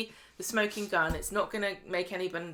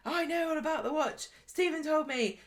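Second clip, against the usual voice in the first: mock angry Lou; 9 semitones higher than usual. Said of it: "Lou storms over going".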